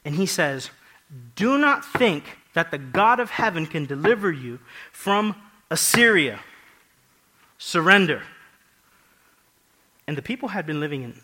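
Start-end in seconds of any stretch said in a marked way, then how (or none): 1.4-8.2 loud, commanding enemy spokesman; Rabshakeh. Acted. King's spokesman demanding the people surrender, loud proclamation. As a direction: proclaim the threat at high volume, ending in a forceful order. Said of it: "the Rabshakeh, which is basically the spokesman".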